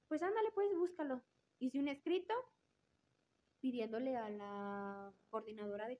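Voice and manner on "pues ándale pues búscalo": mom's reply. Retold or imitated. Mother telling her daughter to go ahead, higher voice. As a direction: answer like a mother saying go for it.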